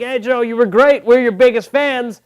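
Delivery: fans greeting. Retold or imitated. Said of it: "they're like".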